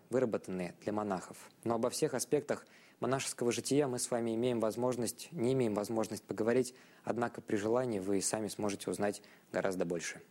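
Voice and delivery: ordinary delivery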